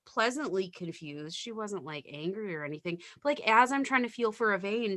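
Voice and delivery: normal talking voice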